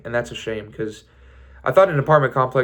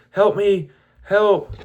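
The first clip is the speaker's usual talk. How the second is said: flat loud-talked cry for help; imitating a deadpan actress calling for help; bad acting; 8 semitones higher, 3 dB louder, much slower than usual. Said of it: "She just, like, loud talks".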